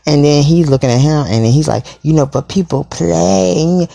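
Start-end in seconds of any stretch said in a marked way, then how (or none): 2.0-3.9 whiny. Retold or imitated. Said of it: "this long little whiny ass thing".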